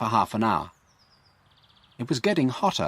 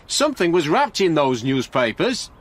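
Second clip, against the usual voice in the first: high and louder, still worked up; excited; 7 semitones higher, 4 dB louder than usual. A narrator's reading. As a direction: keep the excitement from the shout. Frank still shouting about newspapers.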